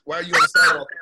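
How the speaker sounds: loud imitated shove-off command; retold bark. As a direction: mimic a South African ordering you off the sidewalk, loud. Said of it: "they're like".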